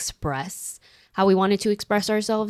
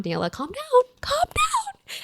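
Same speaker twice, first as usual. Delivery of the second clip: panicked self-soothing; 3 dB quieter, slower than usual. Retold or imitated. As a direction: pitch climbing to a squeak. Retold panicked talk to herself.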